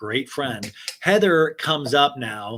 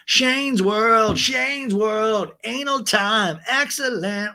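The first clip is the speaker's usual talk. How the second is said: high, excited mock announcer; mock promo hype; 8 semitones higher, 4 dB louder, much slower than usual. Joking about the imagined sex tape, mock hype. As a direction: crow it like a cheesy hype man.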